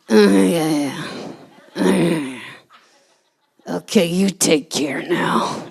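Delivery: Nick Nolte impression. Nolte on phone to mom.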